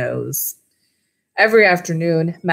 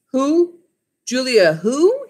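puzzled question; 8 semitones higher, slower than usual. A narrator's reading. Mac puzzled on the phone.

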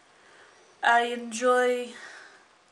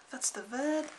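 whispered; 6 dB quieter than usual. Acted. Searching for a word.

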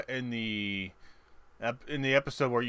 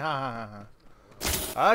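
ordinary talk

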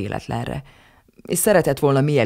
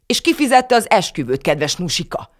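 higher and louder, sarcastic retort; snapping back; 6 semitones higher, 5 dB louder than usual. A narrator's reading. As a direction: fire the question back at Nusika. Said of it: "csattant fel Tibor papa".

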